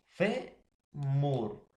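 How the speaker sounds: "femur" split in two, rising stressed "fe"; stress on penultimate syllable. Shown as a demonstration. Teacher giving a grave example, stressed "fe" in "femur". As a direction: lean on "fe", pause, then a long "mur". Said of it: "la mayor fuerza de voz".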